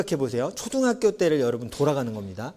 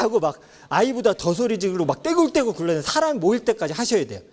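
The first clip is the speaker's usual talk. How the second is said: ordinary talk, nothing marked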